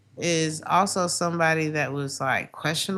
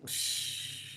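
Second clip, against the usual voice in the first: shushing; 12 dB quieter than usual. Retold or imitated. Hushing in quoted voice.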